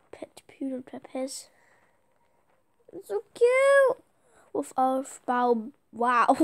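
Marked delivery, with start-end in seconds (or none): 2.9-4.0 gushing. Real, not acted. Gushing over a cute pet.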